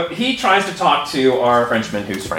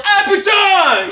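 retold French yell; 14 semitones higher, 3 dB louder, slower than usual. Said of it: "stands up and yells".

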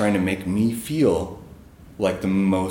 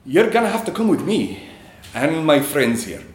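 higher-pitched, attempted Scottish accent; 6 semitones higher than usual. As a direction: imitate a Scottish security man giving an order. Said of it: "try to do a Scottish accent here".

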